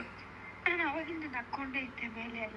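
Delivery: choked up. Real, not acted. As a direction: hold back tears as you speak. Father tearful on birthday call. (ಕಣ್ಣೀರು ತಡೆಯುತ್ತಾ ಮಾತಾಡುವ ಅಪ್ಪ)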